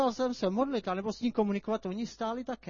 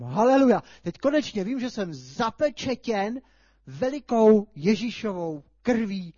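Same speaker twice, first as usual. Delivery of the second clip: joyful praise; 7 dB louder than usual. Retold or imitated. Retold relieved praise.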